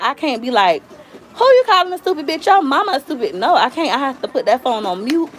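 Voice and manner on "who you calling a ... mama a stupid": high, indignant mock comeback; mock-outraged retort, pitched way up; acted cussing back. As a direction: fire back at an insult, playfully overdone. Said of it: "I can't cuss them back out".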